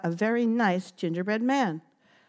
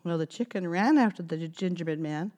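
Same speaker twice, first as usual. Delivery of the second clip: plain reading voice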